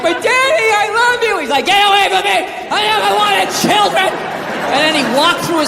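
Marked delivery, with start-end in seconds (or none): 0.0-1.4 high, drawn-out mock wail; hammed-up weepy child voice; crying child. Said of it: "just standing there crying like". 1.7-4.1 high-pitched mock yell of a dad; hammed-up fatherly outburst; angry father. Acts father rejecting crying child.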